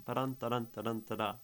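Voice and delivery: ba-DUN da-DUN, the second beat hit each time; rhythmic da-DUN chant like hoofbeats; galloping rhythm. Shown as a demonstration. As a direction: tap out an iambic gallop with the voice. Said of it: "It's like a gallop".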